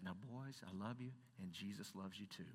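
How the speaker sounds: put-on hushed, low, tender Southern accent; Mister Prince, genteel Southern. Teacher who didn't chide the boys, soft genteel Southern accent. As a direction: imitate a calm Southern teacher, very softly. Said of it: "genteel Southern accent".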